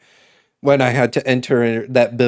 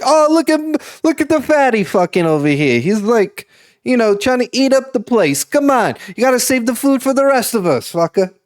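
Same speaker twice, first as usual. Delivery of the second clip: higher, louder mock heckling of a big eater; rowdy Massachusetts local; 10 semitones higher, 3 dB louder than usual. Teasing someone about the food, as a loud, high rowdy local. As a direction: jeer at him like a loudmouth townie. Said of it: "the second one would have been like".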